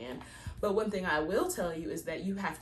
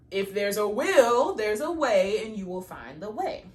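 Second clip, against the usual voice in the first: loud, slow and higher; loud preaching; 4 semitones higher, 10 dB louder than usual. Acted. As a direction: belt the saying out like a preacher. Repeats saying, preaching.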